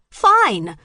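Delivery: high, loud furious scream. A narrator's reading. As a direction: scream it back at her. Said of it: "Stevie screamed".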